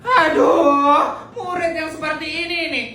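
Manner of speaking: hammed-up fed-up teacher; exasperated teacher. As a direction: sigh over a hopeless pupil. Teacher despairs, punishes.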